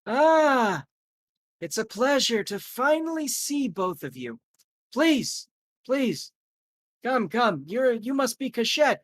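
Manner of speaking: put-on high, cheerful old-school host; Chaim Fetterman welcoming. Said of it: "and he says".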